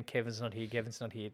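softly repeated, unconcerned mock relay; offhand relayed message. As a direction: pass the message along quietly, without alarm. Retelling message passed along unnoticed.

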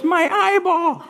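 put-on very high three-year-old voice, drawn out; comic little-kid squeak; Evan. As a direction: squeal it like a toddler cracking a joke. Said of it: "little three-year-old Evan".

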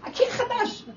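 high, loud shout at the grandkids; retold shout. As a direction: yell to keep them off the new wall. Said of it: "אז אני צועקת".

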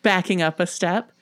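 plain reading voice

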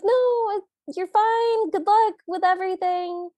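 put-on flustered girl voice. Flustered girl brushing him off.

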